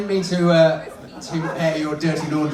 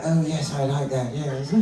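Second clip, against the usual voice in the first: lightly voiced lady dummy; a hint of put-on femininity; feminine voice; 5 dB quieter than usual. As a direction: ladylike puppet approving of itself. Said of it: "let's find a nice feminine voice".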